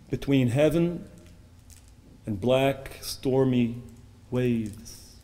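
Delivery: ordinary talk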